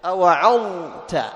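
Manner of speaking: drawn-out unmerged ظ in أوعظت; clear Dha before Ta. Shown as a demonstration. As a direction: sound the ظ fully in أوعظت, then a light ت. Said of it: "تظهر الضاء عند التاء".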